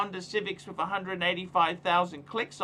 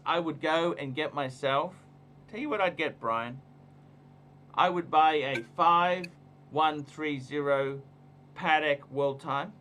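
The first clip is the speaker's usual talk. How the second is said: normal talking voice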